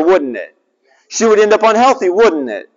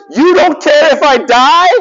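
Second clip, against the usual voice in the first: put-on high, loud, whiny child's wail; mock whiny daughter; 10 semitones higher, 6 dB louder than usual. Daughter whining at dad over chicken, high and loud. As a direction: play the scared little girl wailing at dad. Daughter's anxious whine in story.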